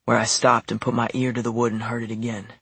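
ordinary delivery

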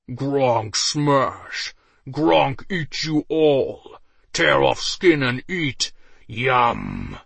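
slow, loud brutish ogre voice; ogre roar. A narrator's reading. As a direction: roar it like a dumb brute. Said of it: "roared loud enough to make the ground shake".

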